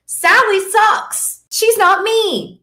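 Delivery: high, drawn-out, emphatic dismissal; defiant outburst. Real, not acted. Coach rejecting a rival's message, defiant outburst. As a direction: fling it out high and slow, defiantly.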